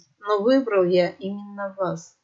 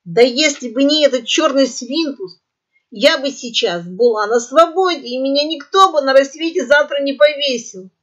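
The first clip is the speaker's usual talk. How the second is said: squawky complaint; 5 semitones higher, 5 dB louder than usual. Acted. Parrot complains loudly.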